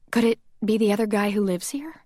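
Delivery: ordinary delivery, nothing marked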